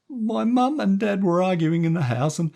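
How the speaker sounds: put-on high, loud anxious child voice; young boy. A narrator's reading. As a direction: gush it out like a scared kid. Boy voice Stevie.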